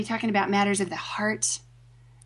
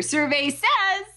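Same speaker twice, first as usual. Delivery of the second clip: slow hammed-up game host call; game show host; 5 semitones higher, slower than usual. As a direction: announce it like a quiz host.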